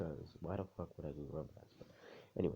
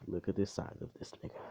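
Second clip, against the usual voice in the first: quick, run-together "look at the size"; rapid first take, no pauses; quick emphatic remark; 2 semitones higher, faster than usual. Real, not acted. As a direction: toss the whole line out fast. Repeats line for comic emphasis.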